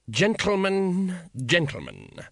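smooth over the quarrel; patrician placating. Acted. Patrician calming the guild leaders, smooth and drawn out.

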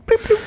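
high squeaky mock horn beeps; playful mock car horn; 1 beep beep horn noise. Paparazzi horn imitation.

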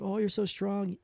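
high, softly gushing fake praise; mock admiration. Older kids humoring a little sister, mock admiration. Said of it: "pretend beat up by her".

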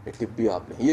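low, soft, pleased offer of a tip; happy generous tip. Retold or imitated. A pleased customer tipping the waiter, soft and glad. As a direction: hand over the tip gladly and softly. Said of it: "اسی خوشی خوشی میں کہتے ہیں".

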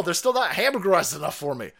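ordinary delivery

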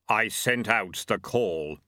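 slow, hollow voice of Death. Acted. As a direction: Death speaking gravely, slowly. Death speaks.

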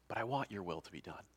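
hushed, low submission; surrender. Acted. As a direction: yield softly, voice dropped. Acts Jesus surrendering.

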